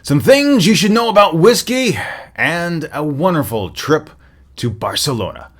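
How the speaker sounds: big, loud, high radio announcer teaser; announcer intro. Acted. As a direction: sell the show like a radio promo. Podcast intro teaser over music.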